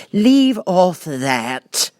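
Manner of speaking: high, loud, slow scream; screamed Queen. A narrator's reading. The Queen at the bowing gardeners, an irritated scream. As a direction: shriek it in irritation. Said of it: "screamed the Queen".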